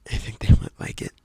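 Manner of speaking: very low, breathy, close to the mic; mock deep mic voice. Shown as a demonstration. Mocking breathy mic voice for the viewers. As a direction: purr it right into the mic for the wow effect. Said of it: "talking for the wow effect".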